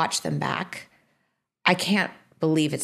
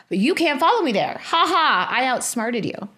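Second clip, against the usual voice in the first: mock gloating; 7 semitones higher, 4 dB louder than usual. Gloating at outwitting the producer.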